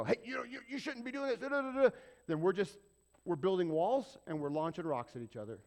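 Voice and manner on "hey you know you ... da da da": high scolding "you shouldn't be doing this"; mock scolding. Pastor mocking a scolding churchgoer, higher. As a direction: nag them like a scold. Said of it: "go to battle with them and go".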